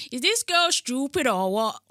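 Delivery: drawn-out mock Bajan disbelief; Bajan granddad impression. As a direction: scold like a granddad with a Bajan accent. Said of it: "That's what my, my granddad said".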